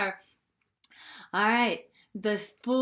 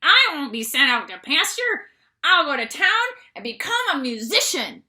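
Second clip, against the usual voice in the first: determined donkey; 7 dB louder than usual. A narrator's reading. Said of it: "said the donkey".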